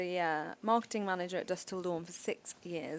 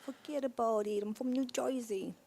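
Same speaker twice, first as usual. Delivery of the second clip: hammed-up Jersey "fuhgeddaboudit"; New Jersey accent; 3 semitones higher than usual. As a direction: play the cartoon New Jersey local. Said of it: "Can you do a New Jersey accent".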